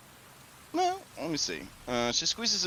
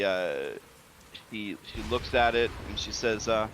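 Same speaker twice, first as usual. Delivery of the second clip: ordinary delivery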